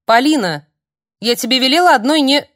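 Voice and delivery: put-on loud, high scolding of a child; mother snapping at her daughter at the door, loud and high; scolding shout. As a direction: call the daughter to order, sharp and loud.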